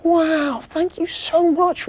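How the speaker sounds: very high, loud, slow amazed thanks; delighted elf. Acted. Marzi thanks Timmy for the present, very high and thrilled. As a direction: gush with gratitude.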